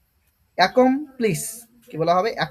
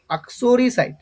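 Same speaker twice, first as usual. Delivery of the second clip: ordinary talk, nothing marked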